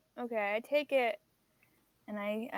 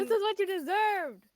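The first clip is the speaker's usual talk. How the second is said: defiant retort; 7 semitones higher, 4 dB louder than usual. Acted. Viper retorts to villain.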